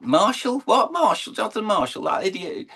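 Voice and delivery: high, incredulous mock voice; mocking doubters. Voicing the doubters mocking him.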